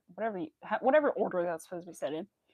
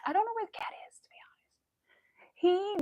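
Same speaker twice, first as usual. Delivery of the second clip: whispered aside; 11 semitones higher, 3 dB louder than usual. Real, not acted. Streamer wondering where the cat is, whispering. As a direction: whisper as if the cat might hear.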